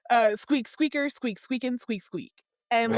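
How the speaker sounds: playful squeaking; chirpy mock squeaks; squirrel squeaks. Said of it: "he starts".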